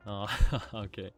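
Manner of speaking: ordinary talk, nothing marked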